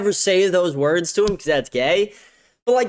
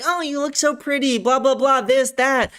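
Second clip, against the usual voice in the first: high, sing-song fake sweetness; high gushing mock compliment; mock girly gushing; 7 semitones higher than usual. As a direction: parody a girl flattering her friend. Mocking girls' fake niceness.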